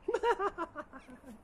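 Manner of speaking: a high, amused laugh falling away; tickled, high-pitched chuckle; laughing at the walking joke. Real, not acted. Laugh after bird joke.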